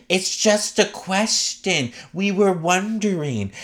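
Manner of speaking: high, drawn-out mock-innocent voice; imitated castmate. Podcaster mimics castmate excusing her accusations, high mock-innocent voice. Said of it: "Whitney will be like".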